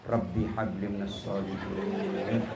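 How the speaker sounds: put-on low, slow whisper into a child's ear; whispered prayer. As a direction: breathe the prayer softly, slowly. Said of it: "Saya bisik telinganya".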